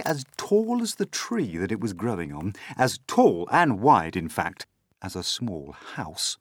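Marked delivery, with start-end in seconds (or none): none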